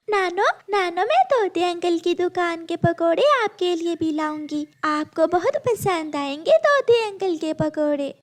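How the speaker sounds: put-on high childlike pleading voice; little girl voice. (ऊँची आवाज़ में छोटी बच्ची)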